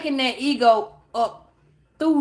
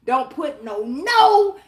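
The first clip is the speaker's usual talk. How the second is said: ordinary delivery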